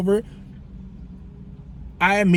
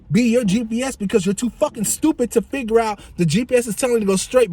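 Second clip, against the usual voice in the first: usual speaking voice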